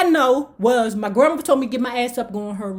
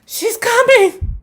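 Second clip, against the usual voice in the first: high, slow, urgent warning; mock alarmed announcing; 12 semitones higher, 3 dB louder, slower than usual. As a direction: announce it like the danger is here. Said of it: "Grandma got up and said".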